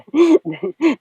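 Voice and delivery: high, loud laughing burst; short loud high laugh; amused laugh. Real, not acted. Laughing at enemies' outrage.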